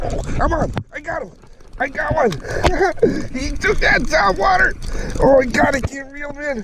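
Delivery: high-pitched, breaking into a laugh; real thrill, struggling with the catch; excited. Real, not acted. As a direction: voice leaps up at the strike. Fish strikes on top water.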